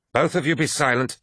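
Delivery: commanding lodge leader. Acted. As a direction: order the quarrellers to stop. Voices Targost calling order.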